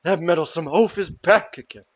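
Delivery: a hint of put-upon mermaid indignation; dismayed mermaid. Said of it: "And she goes".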